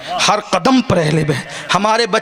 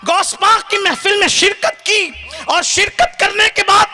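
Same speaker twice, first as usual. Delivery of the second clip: full-force preaching; 11 semitones higher than usual. Acted. Preacher praising Ghaus Pak to the crowd, impassioned high preaching. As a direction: raise the whole hall with rising fervour.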